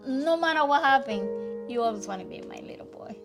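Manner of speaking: ordinary delivery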